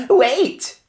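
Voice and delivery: high shouted wait. Acted. Witch sniffing the air, shouting. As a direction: shout to halt them. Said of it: "she shouted again".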